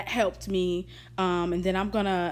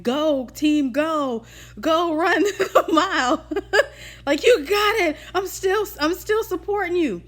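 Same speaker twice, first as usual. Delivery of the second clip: cheering friend; 8 semitones higher, 6 dB louder, slower than usual. Retold or imitated. Cheering friends voiced in retelling.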